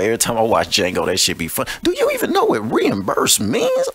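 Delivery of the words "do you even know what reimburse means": very high mocking squeal; squeaky mock. Taunting question, repeated.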